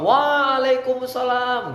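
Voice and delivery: high, drawn-out greeting answer; hearty reply. Shown as a demonstration. Coach acts the audience answering the greeting, hearty. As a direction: answer with gusto, first vowel held long. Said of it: "sedap dia menjawabnya".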